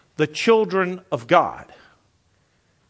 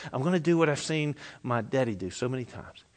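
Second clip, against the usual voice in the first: normal talking voice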